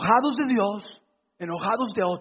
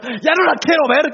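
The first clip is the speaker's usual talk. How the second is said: higher, louder fed-up outburst; mock exasperation; 6 semitones higher, 7 dB louder than usual. Husband kept waiting five minutes, imitated in mock exasperation. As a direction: play the impatient husband, exaggerated.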